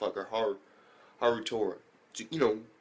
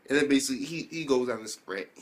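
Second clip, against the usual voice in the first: ordinary delivery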